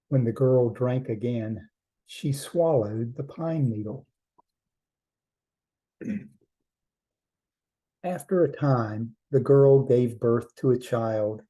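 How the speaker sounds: ordinary delivery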